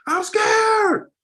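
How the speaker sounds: put-on a little boy yelling his fear through tears; crying out scared. Little boys confessing fear to coach, crying out. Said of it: "These little boys".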